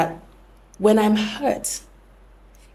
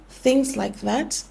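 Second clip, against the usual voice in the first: usual speaking voice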